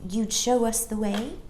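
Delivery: a hint of a shy request; polite. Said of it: "Jane said politely".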